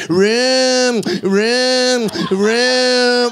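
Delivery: mock motorcycle revving "rim, rim, rim"; engine imitation. Imitating the roommate's motorcycle, mock engine rev.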